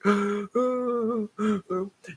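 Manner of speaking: high sudden intake of breath; sharp gasp. Retold or imitated. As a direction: gasp in mock shock. Mock gasp in retold story.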